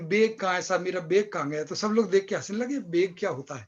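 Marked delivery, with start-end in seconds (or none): 0.0-1.6 "बैग" turned into "beg", Bhopali style; imitated Bhopali vowels on an everyday question; Bhopali accent. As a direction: mimic a Bhopal speaker saying "बैग" as "beg". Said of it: "तो बैग की जगह बोलेंगे".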